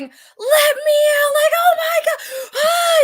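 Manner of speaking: high, loud pretend screams for help; playing the terrified victim in the haunt; mock victim screaming. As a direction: scream like a trapped horror victim. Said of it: "I was acting as a victim".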